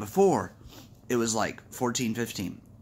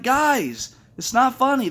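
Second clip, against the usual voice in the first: upset protest; 13 semitones higher, 8 dB louder, slower than usual. Retold or imitated. Hurt on ground, friends laugh.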